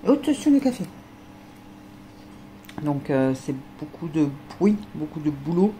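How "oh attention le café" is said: sudden high startled warning. Real, not acted. Owner startled as the dog nears her coffee, high yelp. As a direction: jump up in alarm.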